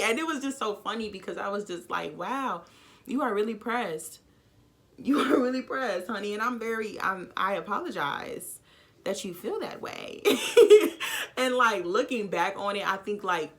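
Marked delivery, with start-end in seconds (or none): 2.2-6.3 mocking. Mocking rival's jealousy. 10.2-11.3 high loud burst of laughter; big high laugh at her own story; loud cackle. Real, not acted.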